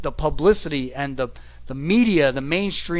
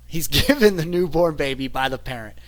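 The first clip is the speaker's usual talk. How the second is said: normal talking voice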